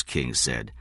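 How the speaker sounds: ordinary delivery, nothing marked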